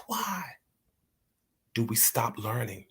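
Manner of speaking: usual speaking voice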